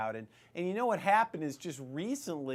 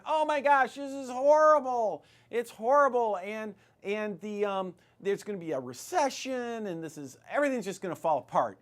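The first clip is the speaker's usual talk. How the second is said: imitating alarmed economists, higher and louder; mock panic; 6 semitones higher, 4 dB louder than usual. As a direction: ham up the doomsayers' panic. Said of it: "all the economists were saying".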